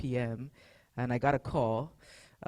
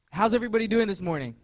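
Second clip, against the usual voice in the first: higher, louder and fast; louder hype greeting; 7 semitones higher, 7 dB louder, faster than usual. Real, not acted. Pastor re-asks the congregation, louder to get them going. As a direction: pump the crowd up on the second try. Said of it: "Everyone's doing good?".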